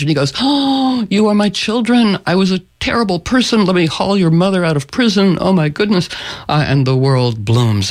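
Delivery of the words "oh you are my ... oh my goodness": high, gasping realization; overcome Sultan. Acted. Sultan recognises his own children, high overwhelmed outburst. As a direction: burst out high, as if suddenly seeing it.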